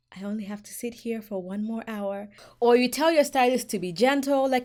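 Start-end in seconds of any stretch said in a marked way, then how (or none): 0.1-2.3 unvoiced, resigned whisper; whispered to self. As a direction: whisper it to yourself, enduring. Sitting in pain, resigned.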